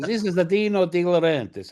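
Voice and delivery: high, put-on Italian accent; Dino De Laurentiis. Producer insists to doubting writer who he is, high Italian accent. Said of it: "when you do the accent".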